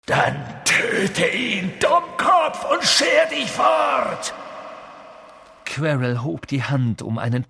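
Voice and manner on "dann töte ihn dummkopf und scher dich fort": furious order. A narrator's reading. Voldemort furiously orders Quirrell to kill Harry. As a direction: spit the command in rage.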